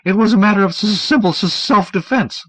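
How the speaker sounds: high, stammering frightened man; nervous stammer. Acted. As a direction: stutter it out high and anxious. Dunlop's stammering voice.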